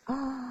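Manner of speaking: soft gasp of awe; breath catching, almost silent; moved gasp. Real, not acted. Host moved by the quote, a hushed gasp. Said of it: "Oh, my God".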